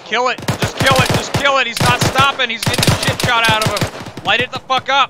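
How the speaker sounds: urgent yelling over gunfire; shouting. Real, not acted. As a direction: bark the orders at full volume. Firefight, target vehicle not stopping.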